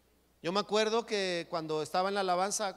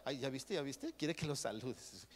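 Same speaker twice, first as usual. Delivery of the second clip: softer snide gossip; gossipy hush; 3 semitones lower, 7 dB quieter than usual. Retold or imitated. Gossiping churchgoer sneering about the greeter, hushed. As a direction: sneer it under your breath.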